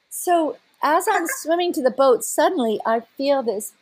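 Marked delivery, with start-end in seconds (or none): none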